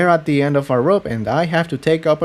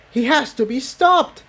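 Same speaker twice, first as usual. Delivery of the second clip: much higher, urgent cry; outraged outburst; 13 semitones higher than usual. Acted. Tiptoe cuts in before Jigsaw can answer, outraged. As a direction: blurt it out in protest.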